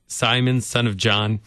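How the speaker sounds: ordinary delivery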